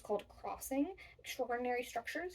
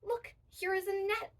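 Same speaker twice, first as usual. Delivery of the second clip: put-on lively girl; 8 semitones higher, 5 dB louder than usual. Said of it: "said Jada".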